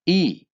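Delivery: ई, high and loud long ee; German I as ee. Shown as a demonstration. Teacher showing German I as ee, high clear call. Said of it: "आई को बोलेंगे Dutch में ई".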